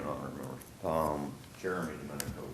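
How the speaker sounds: faint, off-mic voice; speaking away from the microphone; turned away from mic. Real, not acted. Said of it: "Talk into the mic for us".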